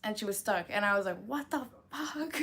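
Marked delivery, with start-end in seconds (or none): 1.2-2.4 slow, drawn-out shocked "what the fuck"; stunned disbelief. Retold or imitated. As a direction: let it hang, baffled and a bit higher. Shocked at dog stuck in fence.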